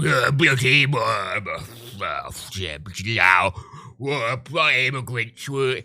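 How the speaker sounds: piss-take of a grumbling bigot; sarcastic bigot voice. Retold or imitated. Showing a voice clip would sound like a piss take, low sarcastic bigot. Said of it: "in a sarcastic tone like that".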